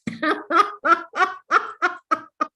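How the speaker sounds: rhythmic rolling laugh; exercise laugh. Shown as a demonstration. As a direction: laugh in an even run, then fade. Teacher laughing between instructions.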